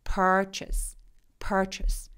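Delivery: purchase with the chase part almost whispered; relaxed schwa. Shown as a demonstration. Teacher showing the weak ending of purchase. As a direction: let chase in purchase fade to a breath. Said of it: "chest 부분을 힘을 쫙 빼시면 돼요".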